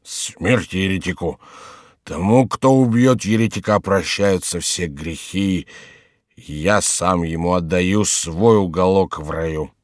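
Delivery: slow, lowish fanatical mumble; slow drunken monk's voice; tipsy monk muttering. Acted. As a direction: drag the vow out drunkenly.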